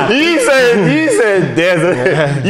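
high, loud, laughing tease; teasing through laughter, slowly; laughing while teasing. Real, not acted. Teasing friend about his saying.

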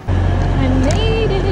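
drawn-out, loud, breathless cheer of relief; loud relieved cry. Real, not acted. Breathless cry to the camera after catching the train. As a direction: shout it out after the sprint to the train.

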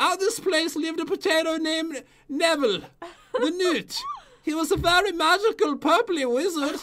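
high-pitched mock British storyteller; British accent. Player introducing a potato wizard, mock British accent. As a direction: narrate grandly in a put-on British accent. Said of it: "read this in a British accent".

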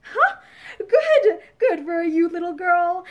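laughing out the praise; big man laughing with approval; hearty laughter. A narrator's reading. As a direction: chuckle through the words, delighted. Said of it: "in hearty laughter".

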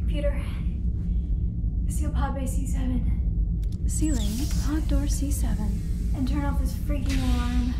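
sci-fi crew member. Acted. Sci-fi roleplay, commanding ship computer.

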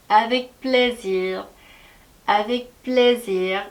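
avec plaisir very slowly, z drawn out; careful slow demo of avec plaisir; slow model pronunciation. Shown as a demonstration. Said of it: "다음 표현도 한번 볼게요".

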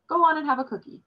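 a father ordering his son to eat; stern order. A narrator's reading. Father ordering his son to take a cookie, sternly. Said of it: "father ordered me sternly".

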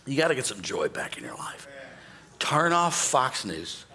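whispered, confiding advice; preacher whispering advice to the crowd; hushed aside. Acted. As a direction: lean in and whisper it.

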